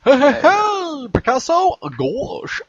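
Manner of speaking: high, loud, slow cartoon shout; cartoon character voice. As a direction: call out like a zany cartoon.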